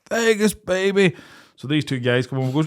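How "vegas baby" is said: high drawn-out party holler; imitating a mate's roar; mock roar. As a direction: stretch it out like a far-off reveller. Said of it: "all I can hear is Sean roaring".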